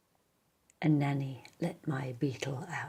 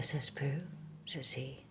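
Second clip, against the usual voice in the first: ordinary delivery, nothing marked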